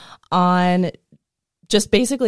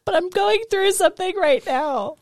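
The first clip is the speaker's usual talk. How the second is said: mock dramatic whine; 12 semitones higher than usual.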